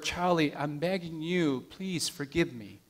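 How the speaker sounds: a mild hushed plea trailing away; quietly begging for forgiveness. Acted. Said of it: "He whispered".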